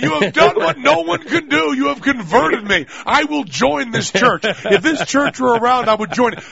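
high, hammed-up hallelujah testimony; mock religious convert. Mock conversion to the monkey church.

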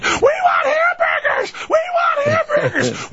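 put-on very high shrieking kid voices; screaming kids. Kids demanding food from the back of the station wagon, screaming. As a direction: whine and scream like impatient children. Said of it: "their eight screaming kids".

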